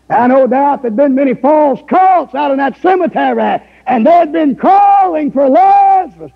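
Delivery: preaching at full force. Acted. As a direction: lift the voice into a preaching cry.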